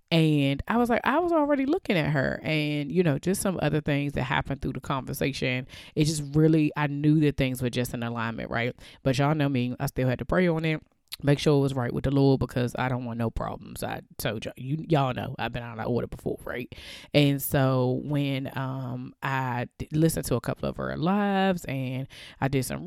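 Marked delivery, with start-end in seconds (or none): none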